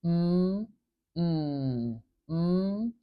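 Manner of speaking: long hummed "mm" sound, lips closed; hummed nasal mm. Shown as a demonstration. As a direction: hum "mm" through the nose. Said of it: "two nasal sounds in this word".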